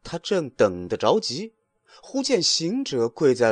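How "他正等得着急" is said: usual speaking voice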